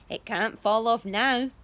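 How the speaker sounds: loud, slow relieved call; shout. A narrator's reading. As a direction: declare it loudly. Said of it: "Aunt Sponge shouted".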